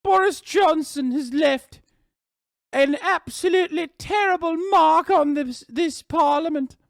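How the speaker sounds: loud, high, pompous parliamentary oration; Widdicombe. Acted. Streamer talking over the news as Widdicombe, high loud declaiming. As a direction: declaim grandly in a high put-on English accent. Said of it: "And Widdicombe accent now".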